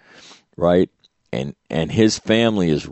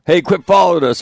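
playful yell; 11 semitones higher, 4 dB louder than usual. Retold or imitated. Yelling at vet across street.

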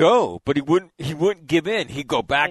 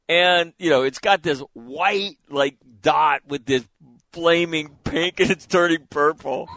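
plain reading voice

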